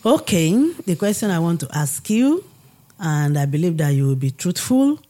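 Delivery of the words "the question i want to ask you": usual speaking voice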